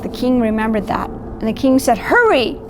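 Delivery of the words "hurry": high urgent storybook call. A narrator's reading. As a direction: call it out high, without a full shout. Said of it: "He called out to his guards".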